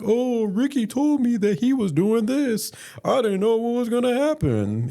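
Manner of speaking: tattling sister. Retold or imitated. Sister covering herself to mom, high-pitched mocking imitation. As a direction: squeal it like a sister playing innocent. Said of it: "my sister's gonna be like".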